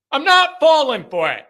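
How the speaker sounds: firm repeat. Real, not acted. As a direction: still loud, with "not" stressed. Repeats refusal, a bit calmer.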